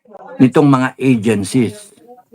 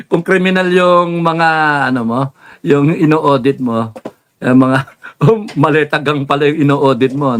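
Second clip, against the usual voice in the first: laughing; 2 semitones higher than usual. Real, not acted. Laughing through the joke.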